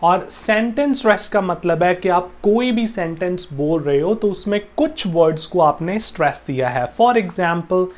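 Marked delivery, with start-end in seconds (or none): none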